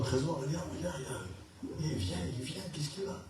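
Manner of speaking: softly urging a friend to look; hushed nudge. Retold or imitated. Nudging Trésor in church, hushed urgent whisper. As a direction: whisper to a neighbour in the pew.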